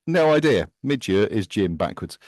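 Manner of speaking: normal talking voice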